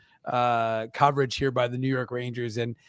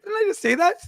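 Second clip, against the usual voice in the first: mock bafflement. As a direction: voice jumps high in mock disbelief. Retells own baffled reaction on air.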